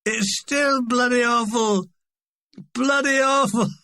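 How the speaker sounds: put-on drunk shouting. Lone drunk heckling the show, shouting. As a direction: bellow it like a heckler in the hall. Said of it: "he shouted from the gallery".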